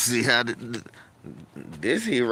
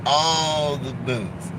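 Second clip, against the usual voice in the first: very slow "all the boots" leaning on "all"; a slow, heavy punchline refrain; drawn-out refrain; much slower than usual. Acted. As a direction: drag every word of "all the boots". Comic refrain.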